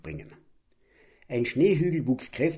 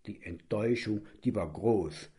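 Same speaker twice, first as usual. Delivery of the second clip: usual speaking voice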